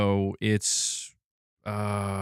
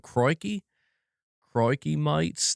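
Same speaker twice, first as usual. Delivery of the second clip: put-on higher-pitched Australian accent, vowels broadened, "mates" as "mites"; attempted Australian accent; 6 semitones higher, slower than usual. Streamer retrying a chat greeting, higher Australian accent. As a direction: have another careful go at sounding Australian. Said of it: "a proper Australian accent".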